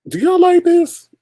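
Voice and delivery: high, slow incredulous squeal; sarcastic sing-song question at the bad design; mock disbelief. Streamer mocking a designer's logo, high incredulous squeal.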